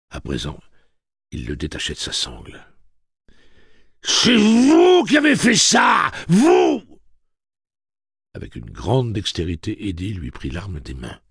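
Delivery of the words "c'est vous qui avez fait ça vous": slow, high, screaming accusation; furious shout. Acted. Grieving father screams blame at Roland.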